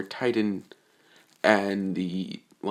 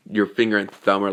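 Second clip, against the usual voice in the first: ordinary delivery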